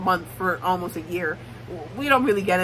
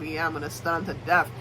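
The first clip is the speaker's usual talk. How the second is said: low, softer mock self-talk; imitated private thought; 6 semitones lower, 6 dB quieter than usual. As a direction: say it to yourself, imitating him. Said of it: "thinking to himself".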